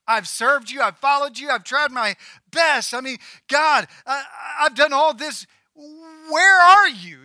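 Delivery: rising to a desperate, drawn-out shout at God; anguished cry. Acted. Preacher voicing Elijah crying out to God, anguished shout building.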